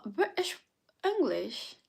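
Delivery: lightly put-on British accent. Shown as a demonstration. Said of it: "would you like me to speak like this".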